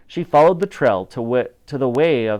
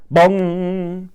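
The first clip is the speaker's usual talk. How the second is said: louder, voiced bell toll; clock bong; 5 semitones higher, 4 dB louder than usual. A narrator's reading. Narrator voicing Big Ben striking, a loud booming bong.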